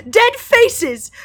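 high, loud cry of horror; shocked, high-pitched horror. A narrator's reading. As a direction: recoil from what's in the water. Sam recoils in horror.

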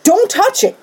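high-pitched warning, normal volume; retold urgent warning. Nemo warns the one eyeing the scepter, retold urgent warning.

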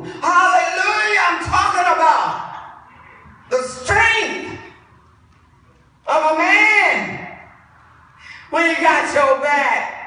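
high, loud hallelujah shouting; full-force preaching. Real, not acted. Preacher praises the strength of a man, shouted preaching. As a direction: punch each phrase out, pausing between.

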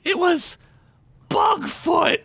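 put-on very high child voice with suspenseful pause; dramatic little girl. A narrator's reading. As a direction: make a big childlike reveal. Little girl's dramatic reveal.

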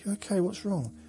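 high, concerned questions; retold worried question. Grandson asking his distressed nan what's wrong, high and worried. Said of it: "I said".

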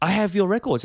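put-on proud fan in an Italian accent. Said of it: "and then he goes".

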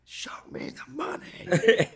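high, slow whispery squeal; whispered mock cry, drawn out; hushed movie catchphrase. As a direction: stretch the quote out in a high, breathy whisper. Movie catchphrase acted in hushed falsetto.

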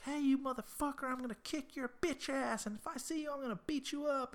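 high-pitched, hammed-up tough talk; mock threatening caller. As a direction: imitate an angry voicemail in a high voice. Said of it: "people threatening him".

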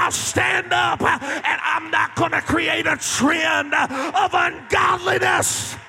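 loud, rising pulpit preaching; fiery sermon voice, slowing on the last lines; full-force preaching. Acted. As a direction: preach it to the back row.

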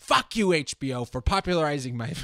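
high, loud mock yell; angry shout. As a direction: yell it with defiance. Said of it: "He should have just been like".

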